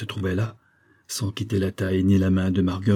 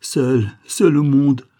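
louder, higher cry of grief; despairing cry; 5 semitones higher, 6 dB louder, slower than usual. A narrator's reading. Widowed father cries out over the deathbed, anguished. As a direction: let the grief break out. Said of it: "criait-il".

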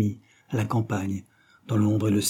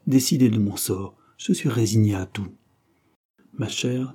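ordinary delivery

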